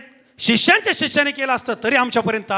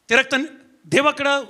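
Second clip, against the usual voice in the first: usual speaking voice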